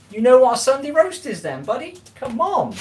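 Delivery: high, loud disbelief at the viewer; incredulous. Real, not acted. Streamer teases a viewer from England, high incredulous. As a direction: come on, you can't be serious. Teasing a viewer who lacks roast.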